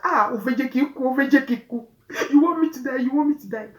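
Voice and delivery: high, loud panicked yelling; hysterical yelling. Retold or imitated. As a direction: shout it as if you're dying. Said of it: "Becca was screaming".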